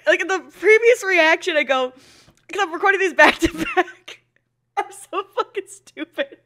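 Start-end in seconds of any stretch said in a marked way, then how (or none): none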